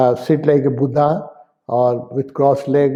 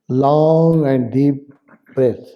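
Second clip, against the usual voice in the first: drawn-out guidance; much slower than usual. Shown as a demonstration. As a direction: stretch out "Long" slowly. Models a long breath while guiding.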